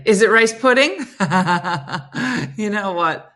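loud laugh through the words. Real, not acted. Vlogger laughing at a viewer's rice pudding callback. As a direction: crack up at the joke.

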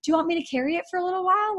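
high, light encouraging voice of the Lord; cheerful encourager. Retold or imitated. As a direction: play a loving father cheering on a small child. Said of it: "it's just like".

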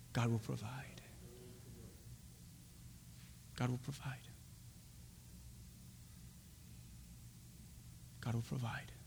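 very soft, low whisper. Shown as a demonstration. Abraham to his son before the sacrifice, whispered. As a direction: breathe it out under your voice. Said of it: "Just whisper it".

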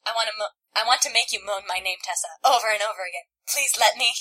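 ordinary delivery

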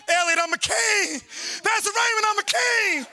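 high, proud call across the church; exultant preaching. Real, not acted. Preacher telling fellow ministers he's a king, exultant preaching.

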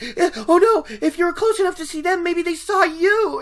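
high, loud mock panic; panicky girl. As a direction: squeal it out like a scared village girl. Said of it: "by panicking a little".

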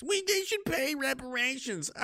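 high slow mocking whine; imitating the naive demand; lightly mocking. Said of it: "I thought this was hilarious".

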